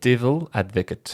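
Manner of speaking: "Devil Advocate" slowly, word by word; slow repeat. Shown as a demonstration. Repeating a film title for a confused friend, slowly. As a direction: separate "Devil" from "Advocate" with a small gap. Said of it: "Say that again slowly".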